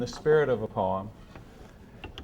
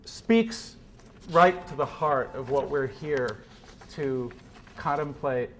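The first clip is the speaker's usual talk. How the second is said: usual speaking voice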